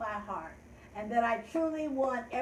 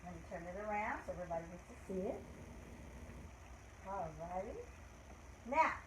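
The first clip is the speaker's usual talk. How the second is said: ordinary talk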